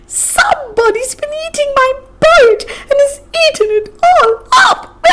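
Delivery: shrill little bear. Acted. Wee bear wailing that his porridge is gone, high and shrill. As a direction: squeal it like a tiny upset cub. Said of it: "said in a shrill little voice".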